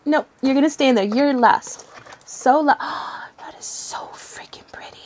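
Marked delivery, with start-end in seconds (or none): 2.8-3.3 soft voiceless gasp; amazed gasp. Real, not acted. Sees pretty deck. 3.4-5.0 very soft whisper of wonder; awestruck whisper. Real, not acted. Awestruck at the deck.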